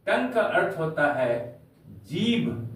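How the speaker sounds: usual speaking voice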